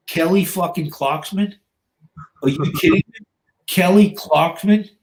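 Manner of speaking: imitating a dumbfounded gym bro; retold incredulity. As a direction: stretch the name out as if appalled. Retold guy discovers the music, incredulous.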